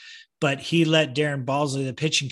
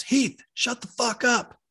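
high, drawn-out imitation of a coach's telling-off; mock-exasperated coach cutting off a player; blunt coach rebuke; 9 semitones higher than usual. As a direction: slowly scold as the blunt coach, pitched up.

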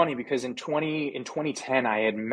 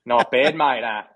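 put-on playful, louder Aussie accent; Australian accent; 8 dB louder than usual. Player answering host's praise of his accent, playful Australian accent. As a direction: ham up the Australian accent for fun. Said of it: "the ability to do an Australian accent".

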